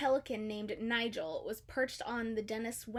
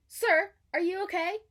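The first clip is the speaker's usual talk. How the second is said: high, louder caring question; friendly concern; 7 semitones higher, 8 dB louder than usual. A narrator's reading. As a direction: ask kindly after the crash. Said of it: "asked the friendly blue fish".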